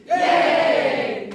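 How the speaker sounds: loud high yay; loud high cheer. Real, not acted. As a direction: cheer the group. Cheering the class.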